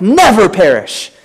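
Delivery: never punched with a falling tune; emphatic stress on never perish. Shown as a demonstration. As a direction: hit never hard, let perish fall.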